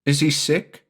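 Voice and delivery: lightly voiced young son; little boy Jamie. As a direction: ask like a small boy puzzled.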